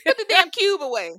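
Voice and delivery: high, shouted mock order; loud punchline. Grandma corrected to a cube, loud mock order. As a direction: shout it high through the group's laughter. Answering grandma's joke, everyone laughing.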